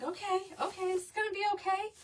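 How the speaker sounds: high, quick, hurried reassurance; nervous self-reassurance. Retold or imitated. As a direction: talk yourself into calm, pitched high. Retells reassuring herself before the ride.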